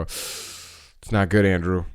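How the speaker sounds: very slow, grave fake concern; mock grave brother. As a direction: drag it out like bad news.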